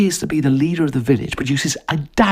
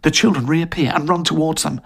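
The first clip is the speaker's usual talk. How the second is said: plain reading voice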